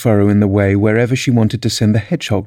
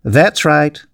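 high-pitched royal approval; mild, raised-pitch shout; 5 semitones higher than usual. A narrator's reading. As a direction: call it out like a queen. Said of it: "shouted the Queen".

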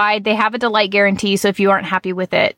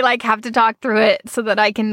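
normal talking voice

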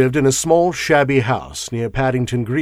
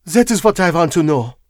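put-on higher, pressing foreign-accented statement; suppressed eagerness; 5 semitones higher, 4 dB louder than usual. A narrator's reading. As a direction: press the point with contained eagerness. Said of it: "suppressed eagerness in her manner".

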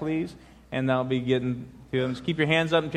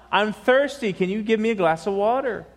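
put-on higher-pitched kid voice; little boy's raised, wheedling request; pleading boy; 6 semitones higher, 3 dB louder than usual. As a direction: plead like a child in bed. Boy pleads again with mother.

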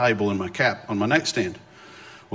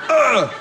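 loud, high groan of despair; hammed-up "ugh" of defeat; exasperated groan; 12 semitones higher, 5 dB louder than usual. Reliving everything falling apart, a despairing groan.

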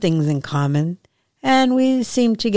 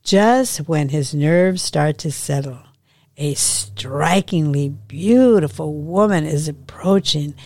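ordinary delivery

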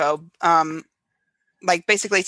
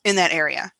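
normal talking voice

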